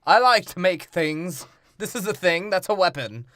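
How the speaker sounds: hammed-up inventor voice; goofy inventor. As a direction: explain gadgets like a proud simpleton. Voicing Q character.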